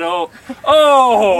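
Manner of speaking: loud startled cry; shocked shout. Real, not acted. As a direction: yelp in shock.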